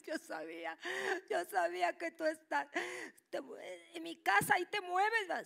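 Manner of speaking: thrilled high squeal of recognition; mother shrieking she knew it. Retold or imitated. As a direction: burst out overjoyed. Mother thrilled it is her father.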